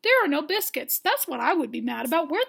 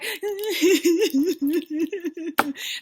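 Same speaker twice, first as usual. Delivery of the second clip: amused laugh; 3 semitones higher, 4 dB louder than usual. Real, not acted. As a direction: burst of real laughter. Laughs after quote.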